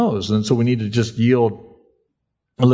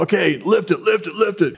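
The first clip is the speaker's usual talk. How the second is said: higher coach chant pushing the lifter; pushy coach; 7 semitones higher than usual. Retold or imitated. Gym coach urging a tired lifter, higher pushy chant. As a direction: bark out each lift it. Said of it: "coach that's kicking your butt and says".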